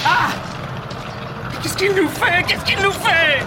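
high, loud terrified cry; panicked shout. Acted. Prisoner dragged off, shouting in panic. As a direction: scream the question in terror.

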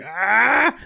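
high, loud anguished cry; pained cry. Acted. Fleeing woman cries out clutching her head, high and loud. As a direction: grab your head and cry out. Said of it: "she grasps her head and she's like".

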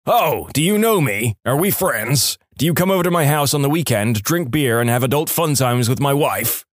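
plain reading voice

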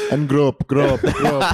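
put-on lightly comic Indian accent. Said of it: "that's a good accent".

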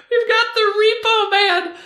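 high, squeaky words squeezed through laughter; helpless laughter. Real, not acted. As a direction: try to announce it while cracking up. Cracks up introducing the next wrestler.

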